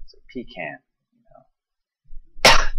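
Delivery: mock haughty English "pecan", pe-KAHN, drawn out; foppish pecan. Shown as a demonstration. Mocking haughty English people who say pecan, in a snooty drawl. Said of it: "sounds kinda foppish, like you're some sort of haughty English person".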